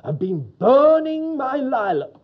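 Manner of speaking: loud, slow, high-pitched indignant complaint; an angry neighbour bursting out; slow, loud outrage over the lilac; thunderous complaint. Retold or imitated. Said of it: "his face looked like thunder".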